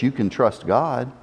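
low and soft, almost to himself; quiet declaration. Acted. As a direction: let it fall soft and low. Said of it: "still declares with his lips".

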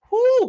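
high, loud whoo of praise. Real, not acted. Preacher praising God mid-testimony, loud whoo.

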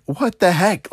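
high, incredulous what the heck; disbelief. Retold or imitated. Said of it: "I was just like".

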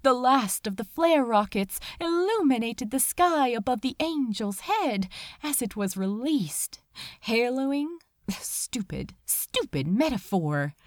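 usual speaking voice

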